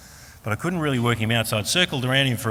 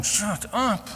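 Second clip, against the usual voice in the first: very high mock scolding; high-voiced comic telling-off; imitated annoyed wife; 12 semitones higher, slower than usual. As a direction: play an exasperated wife, pitched way up. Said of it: "My wife's going".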